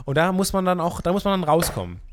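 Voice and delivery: usual speaking voice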